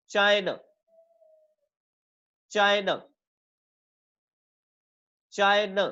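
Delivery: "China" with a long चाय and short ना; stress on first syllable. Shown as a demonstration. Teacher modelling word stress, first syllable of "China" longer. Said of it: "चाय बड़ा है".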